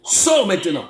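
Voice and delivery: high, forceful shouted order; exorcist's command. Real, not acted. Prophet orders a spirit to leave, shouted command. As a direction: drive the spirit out.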